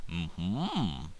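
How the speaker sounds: ordinary talk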